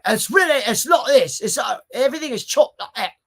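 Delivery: high, chopped-up Cockney; attempted Cockney accent. Shown as a demonstration. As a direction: channel a rough-and-ready Cockney, every word chopped. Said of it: "I gotta work on that one".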